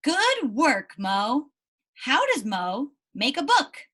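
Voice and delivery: normal talking voice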